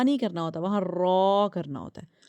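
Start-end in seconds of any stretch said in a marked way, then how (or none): 0.8-1.5 "را" said thick, rounded towards 'raw'; a full, heavy "را" with an 'aw' vowel. Shown as a demonstration. Teacher showing pupils the required reading, thick ra. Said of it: "وہاں باریک نہیں پڑھنا ہوتا".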